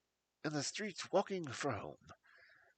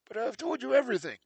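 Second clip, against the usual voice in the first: fast, higher, louder protest; insistent protest; 8 semitones higher, 7 dB louder, faster than usual. Acted. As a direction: blurt out a defensive denial. Blessington protests to Holmes.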